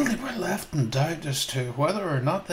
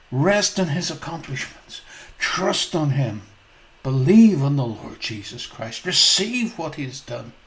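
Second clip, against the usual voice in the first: ordinary talk, nothing marked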